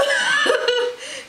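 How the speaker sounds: high real laughter; bright burst of laughing. Real, not acted. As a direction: break into laughter. Cat pops up, she laughs.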